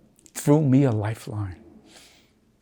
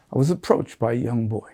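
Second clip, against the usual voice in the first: usual speaking voice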